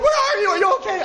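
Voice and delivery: a frantic friend whose call went unanswered, high and anxious; panicked caller. Retold or imitated. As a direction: squeal with mock panic. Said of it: "someone's go".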